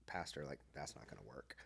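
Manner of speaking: a quiet private aside; inner thought. Retold or imitated. Speaker telling himself to go sit with the lonely man. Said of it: "And I'm like".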